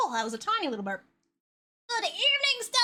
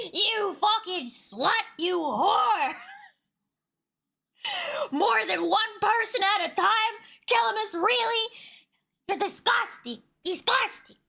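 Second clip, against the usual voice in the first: mock outrage; 7 semitones higher than usual. Mock outrage at raiding viewers.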